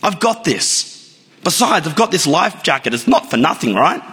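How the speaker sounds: high, brash boasting voice; cocky swimmer. Acted. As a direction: brag like a state champ, sure of himself. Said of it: "he says".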